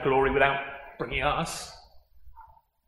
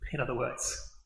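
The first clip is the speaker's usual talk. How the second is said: normal talking voice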